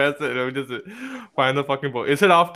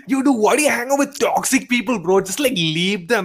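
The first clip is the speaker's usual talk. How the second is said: higher-pitched mock-exasperated dude voice; imitated preachy poster; 3 semitones higher, 3 dB louder than usual. Friend mimicking someone's post to his co-host, mock preachy bro. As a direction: imitate a bro lecturing on toxic people. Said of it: "a post of someone who's like".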